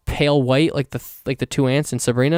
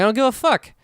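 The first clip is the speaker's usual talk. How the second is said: high-pitched fed-up outburst; exasperated rant; 8 semitones higher than usual. Retold or imitated. Griping about the book banning plot, high and exasperated. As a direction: pitch way up, throw it all away. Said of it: "I'm like".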